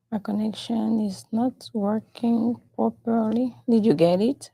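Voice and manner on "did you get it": normal talking voice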